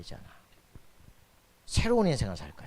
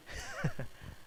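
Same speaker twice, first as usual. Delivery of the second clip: quiet, high breathy laugh; real light chuckle; soft chuckle; 8 dB quieter than usual. Real, not acted. Preacher amused after mimicking complainers, soft chuckle.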